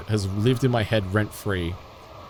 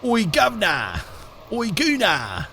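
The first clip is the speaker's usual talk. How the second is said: high British caricature call, falling away; terrible British accent; 8 semitones higher than usual. Acted. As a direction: holler it in a mock Cockney British accent. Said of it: "my own terrible accents".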